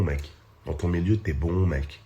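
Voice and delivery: normal talking voice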